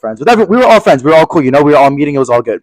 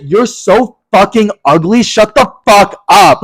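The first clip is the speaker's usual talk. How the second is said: high, drawn-out insult; hammed-up high, slow, emphatic fury; retold crazy outburst; 5 semitones higher, slower than usual. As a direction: act out losing it, stretching each word slowly. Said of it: "I was being crazy".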